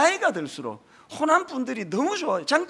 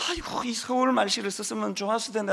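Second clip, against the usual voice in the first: regretful sigh; 6 dB quieter than usual. Retold or imitated. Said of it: "신학교 처음 갔을 때는".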